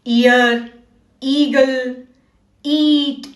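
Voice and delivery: ईयर, ईगल, ईट with stretched "ee"; long E sound. Shown as a demonstration. Teacher contrasting with short sound, long "ee" in ईयर, ईगल, ईट. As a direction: hold the "ee" in each of ईयर, ईगल, ईट. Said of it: "और long sound".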